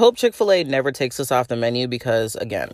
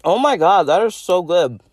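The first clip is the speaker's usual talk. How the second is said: savoring delicious food; 7 semitones higher than usual. Real, not acted. First bite of brownie.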